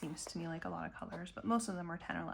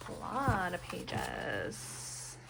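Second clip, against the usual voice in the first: "lot" slow and falling, drawn out; slow drawn-out echo; much slower than usual. Real, not acted. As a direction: stretch "A lot of pages" out slowly. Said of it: "slow echo of own remark".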